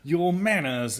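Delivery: feminine Foghorn Leghorn. Retold or imitated. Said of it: "a more feminine version".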